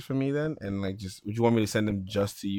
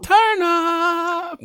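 loud high party yell; hype shout; 7 dB louder than usual. Acted.

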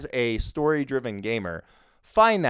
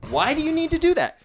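much higher incredulous question; exasperated; 14 semitones higher than usual. Real, not acted. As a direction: ask it in total disbelief.

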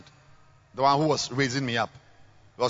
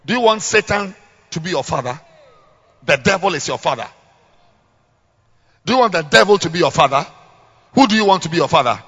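fast, loud preacher's questions; preaching; 3 semitones higher, 8 dB louder, faster than usual. Acted. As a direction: hammer the questions home.

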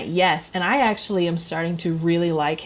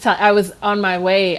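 ordinary talk, nothing marked

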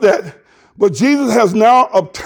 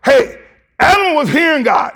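preacher's shout; 5 semitones higher than usual. Real, not acted. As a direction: punch it out to the congregation. Preacher answering the doubters.

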